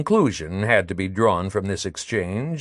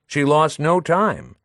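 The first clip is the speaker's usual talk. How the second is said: ordinary talk, nothing marked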